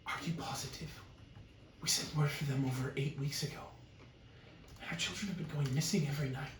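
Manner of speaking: worried village woman. Acted. Woman urging her companion about missing children, hushed and worried. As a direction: plead quietly, pitch lifting as it goes on. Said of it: "And the woman's like".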